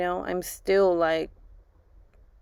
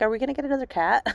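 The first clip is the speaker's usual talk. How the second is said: put-on higher, quick child's question; eager little boy; 6 semitones higher, much faster than usual. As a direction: rattle it off like an eager kid. Mom voicing son asking for cat.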